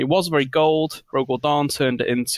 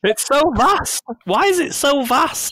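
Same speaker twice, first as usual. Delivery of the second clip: high, put-on gawping voice; hammed-up high wonder; awed guardsman; 13 semitones higher than usual. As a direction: marvel like an overwhelmed soldier. Guardsman gawps at the body.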